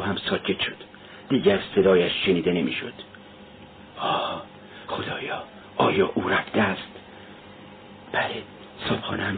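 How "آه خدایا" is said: breathless whisper to God; whispered plea. Acted. Hiding in his room, whispering to God in fear. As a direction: whisper it, afraid to be heard.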